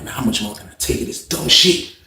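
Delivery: low, softened weary thought; inner grumble. Retold or imitated. Fed-up man thinking to himself about his cellmate, low inner grumble. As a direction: say it privately, low and hushed. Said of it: "I'm saying in my head".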